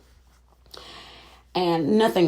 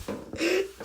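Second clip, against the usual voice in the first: shrieking giggle, much higher; high squealing laugh; 5 dB quieter than usual. Real, not acted. Laughs at her own joke.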